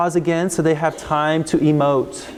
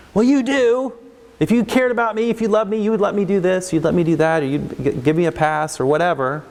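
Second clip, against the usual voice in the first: put-on escalating kid; 2 semitones higher than usual. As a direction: push back like an upset student. Child escalating against teacher.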